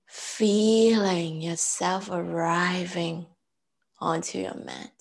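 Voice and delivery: slow, measured meditation voice with long pauses; guided breathing. Real, not acted. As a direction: count them calmly through each breath, unhurried. Leads breath counting and meditation.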